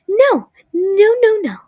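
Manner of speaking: high, loud, crying no no no; tearful panic. Acted. Marionette begging not to call police, crying and loud. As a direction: beg through tears, high and loud. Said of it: "starts to shake and tears are in her eyes".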